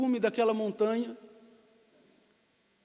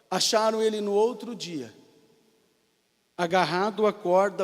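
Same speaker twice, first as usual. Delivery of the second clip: ordinary delivery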